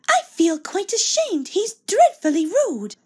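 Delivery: second coach chirping back in shock; twittering coach. Acted. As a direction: flutter it out like a scandalised hen. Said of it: "they twittered to each other".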